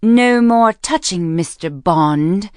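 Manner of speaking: loud, slow mock-posh British; hammed-up, drawn-out posh British; posh British accent. A narrator's reading. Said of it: "very poor imitation of a posh British accent".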